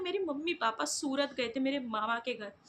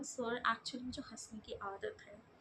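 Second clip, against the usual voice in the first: whispered confession; 3 semitones lower, 12 dB quieter, slower than usual. Retold or imitated. Confessing habit to interviewer.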